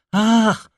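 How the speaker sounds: high sighing "Ach"; a sharp high sigh of shock; young woman's startled sigh. A narrator's reading. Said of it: "westchnęła Eugenia".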